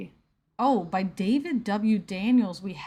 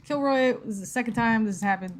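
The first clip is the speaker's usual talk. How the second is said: ordinary talk, nothing marked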